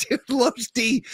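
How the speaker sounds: laughing through the name; chuckling as he says it. Real, not acted.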